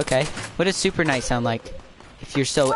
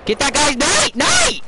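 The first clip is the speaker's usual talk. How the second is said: much higher, louder yelled callout; frantic shout; 13 semitones higher, 5 dB louder, slower than usual. Real, not acted. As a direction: scream at the team to finish him. Urgent kill callout in a fight.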